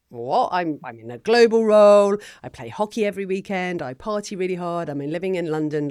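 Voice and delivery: mock indignant, starting high and loud; incredulous retort. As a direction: scoff at the question as if life is already perfect. Retold reaction at the workshop.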